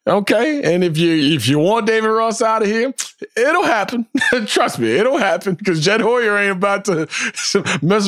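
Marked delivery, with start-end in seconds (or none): none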